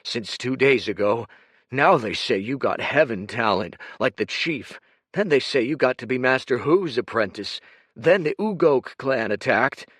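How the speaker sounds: growled. Acted. Kuruk confronting Dorian, growling. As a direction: growl the suspicion.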